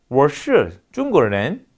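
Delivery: "是" with a curled-back tongue, very slow; Chinese retroflex example. Shown as a demonstration. Lecturer gives an example sentence, "是" as a slow retroflex. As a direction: say "我是中国人" slowly in Mandarin, retroflex on "是". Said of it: "지금 제 발음이".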